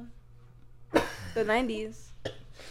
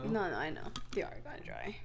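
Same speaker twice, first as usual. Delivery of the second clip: soft whispered tease; whispering; 9 semitones lower, 14 dB quieter, faster than usual. Real, not acted. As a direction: whisper it as a secret. Whispered teasing aside.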